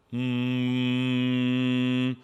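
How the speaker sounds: long steady resonant hum; sustained front-placed "mm"; humming. Shown as a demonstration. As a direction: hum on one held note. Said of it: "is humming".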